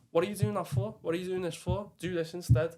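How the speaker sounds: lightly voiced coach correcting him; coach telling off. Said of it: "They tell me, like".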